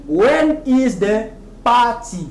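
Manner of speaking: slow, word by word, "party" as "PAH-tee"; clear pronunciation. Shown as a demonstration. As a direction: spell it out slowly with a clear T in "party". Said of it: "avec une prononciation claire".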